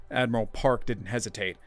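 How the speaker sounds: plain reading voice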